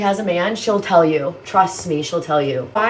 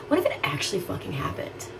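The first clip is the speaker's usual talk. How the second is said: whisper; 10 dB quieter than usual. Real, not acted. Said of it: "unvoiced whispered aside".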